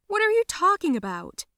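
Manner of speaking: high, loud baffled protest; indignant disbelief. Acted. Mother denying her daughter's accusation, indignant. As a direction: throw it back, high and bewildered.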